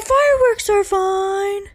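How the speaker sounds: high, slow, airy mock-mom voice; hammed-up careless mother; dismissive mom. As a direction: brush it off lazily and high.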